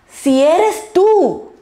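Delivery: emphatic mock doubt, high and slow. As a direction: question him openly, dragging "tú". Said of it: "Ahí hubo un poquito de duda".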